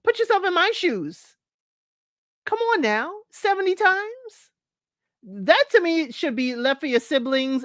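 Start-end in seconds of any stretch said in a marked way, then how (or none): 0.0-4.5 high, pleading disbelief; incredulous and worked up; exasperated. Real, not acted. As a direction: come on now, really?. Exasperated pleading, much higher.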